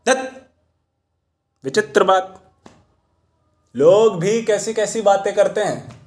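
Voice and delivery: high-pitched, incredulous scoff; scoffing dismissal. Acted. Mahout dismisses what people say about God, scoffing. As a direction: wave the idea away, pitch jumping up.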